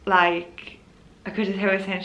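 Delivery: ordinary delivery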